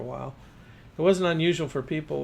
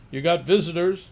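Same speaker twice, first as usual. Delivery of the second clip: louder call into the house; yelled call; 4 semitones higher, 6 dB louder than usual. A narrator's reading. Father tells his son about visitors, a loud call. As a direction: call out to the boy upstairs. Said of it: "he yelled into the house".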